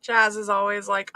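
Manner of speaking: usual speaking voice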